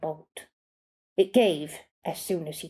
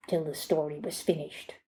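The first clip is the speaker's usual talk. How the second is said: plain reading voice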